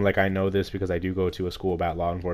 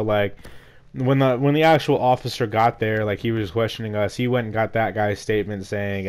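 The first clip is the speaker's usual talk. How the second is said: normal talking voice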